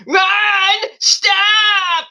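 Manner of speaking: very high, drawn-out mock scream; Sammy Sweetheart yell. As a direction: wail it like a girlfriend yelling at her boyfriend. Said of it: "just to yell at Ron".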